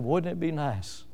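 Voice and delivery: soft sigh for the hometown well; wistful sigh. Retold or imitated. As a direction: breathe it out like a homesick wish. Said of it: "He sighed".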